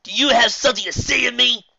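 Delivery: loud, high bully threat; menacing shout. Acted. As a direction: get right in their face.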